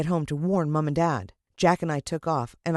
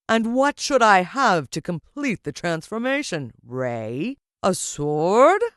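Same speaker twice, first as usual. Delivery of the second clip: louder, slow, triumphant villain proclamation; grand villain address; 7 dB louder, slower than usual. Acted. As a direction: spread your arms and own the crowd. Villain voice held.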